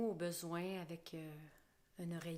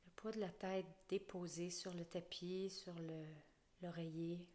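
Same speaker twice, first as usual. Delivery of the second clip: guided relaxation. Acted. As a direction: lull the listener down into the floor. Guides a body scan relaxation.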